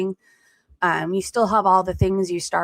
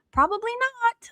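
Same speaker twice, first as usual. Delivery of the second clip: sarcastic high retort. Real, not acted. Dismissive reaction to a comment.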